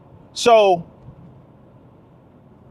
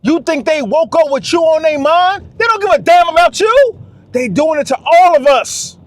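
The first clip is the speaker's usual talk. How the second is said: higher and louder, calling it out; fired-up shout; 7 semitones higher, 4 dB louder than usual. Real, not acted. Warning the fellas they're targeted, in a rising shout. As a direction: raise the voice and hammer the warning.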